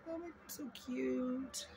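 high, slow, soft coo. Real, not acted. Vlogger coos over cute animal stickers, high and soft. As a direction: coo at them like at a puppy.

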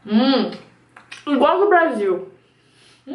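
chewing while talking; mouth full chewing. Real, not acted. Seen 0: Bites rice cake at -3, visibly chewing with full cheeks throughout.

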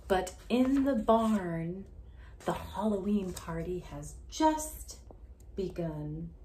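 loud, slow, dramatic read-aloud; storybook reading. Acted. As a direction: lean into each word for suspense. Story narration, louder slower.

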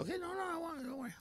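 high, soft soothing; placating husband. Retold or imitated. Preacher plays himself reassuring wife, high and hushed. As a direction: coo it to calm your wife.

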